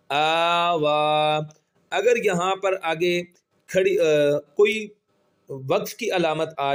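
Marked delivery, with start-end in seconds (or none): 0.1-1.4 آ وا with both vowels held one alif long; very slow stretch. Shown as a demonstration. Teacher stretching both long vowels for students. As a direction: draw out آ and وا evenly and slowly. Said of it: "ایک الف کے برابر کھینچ کر پڑھیں گے".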